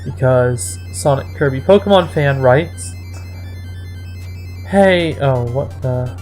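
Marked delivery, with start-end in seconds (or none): none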